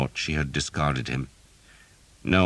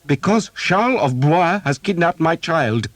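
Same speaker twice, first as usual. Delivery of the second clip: higher, louder, angry noblewoman; 14 semitones higher, 6 dB louder than usual. A narrator's reading. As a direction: throw it back at him in anger. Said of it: "Jeanette answered angrily".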